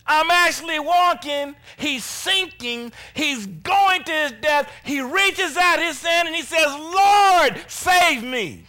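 loud, high preacher retelling with drawn-out cries; full-force preaching. Acted. Preacher voicing the sinking man's cry to the Lord, full-force preaching. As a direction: build to a shouted plea.

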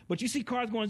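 ordinary talk, nothing marked

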